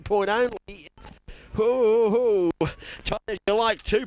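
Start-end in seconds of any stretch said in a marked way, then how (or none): 1.5-2.7 loud excited hoo hoo hoo; excited hooting. Real, not acted. Commentator hooting at the tight finish, excited. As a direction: hoot with delight at the tight finish. Excited whooping at close game.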